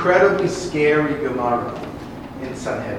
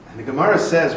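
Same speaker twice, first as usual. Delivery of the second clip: usual speaking voice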